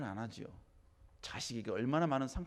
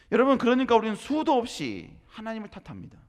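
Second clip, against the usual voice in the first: higher, louder sermon proclamation; forceful preaching; 8 semitones higher, 10 dB louder than usual. Acted. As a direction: drive the point home to the congregation. (힘차게 외치는 설교)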